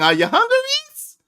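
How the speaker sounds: "Are you hungry" plain, "Reese?" high; plain ask, then high "Reese?"; plain question, high "Reese". Retold or imitated. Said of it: "That's their thing".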